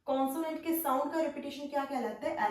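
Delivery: normal talking voice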